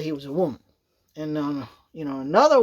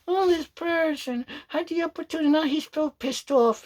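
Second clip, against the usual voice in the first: mocking quote; 7 semitones higher, 3 dB quieter than usual. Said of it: "I hate when they say".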